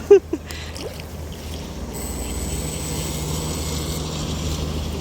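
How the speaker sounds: long, high, soft laugh; laughter. Real, not acted. Friends planning a boodle fight, long laughing. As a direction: laugh on softly in a high pitch.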